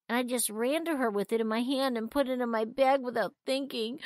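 put-on a touch of weeping in a child's confession; little brother tearfully confessing to his sister; sobbing. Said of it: "he sobbed".

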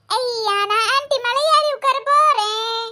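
put-on slow, drawn-out kid glee; five-year-old child. Child about to climb onto auntie, childlike. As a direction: announce it like a delighted small child. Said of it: "அஞ்சு வயசு குழந்தைதான்".

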